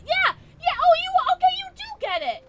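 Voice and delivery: very high, very loud outburst; excited shouting. Real, not acted. Streamer finding a viewer gets it, high excited shouting. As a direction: burst into squealed agreement.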